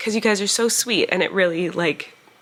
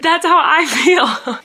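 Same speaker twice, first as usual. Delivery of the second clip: inner voice exclaiming; 10 semitones higher, 7 dB louder, much slower than usual. Retold or imitated. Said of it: "my head said".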